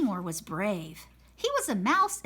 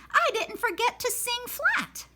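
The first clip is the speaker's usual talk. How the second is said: excited cat; 13 semitones higher than usual. Acted. Happy cat after show.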